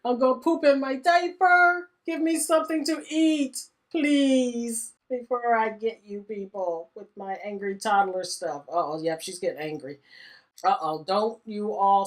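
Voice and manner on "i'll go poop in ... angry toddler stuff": put-on high, pleading hungry toddler; bratty toddler demanding food; whiny toddler voice. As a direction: beg like a starving little kid, higher and louder. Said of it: "the kids are on looking like".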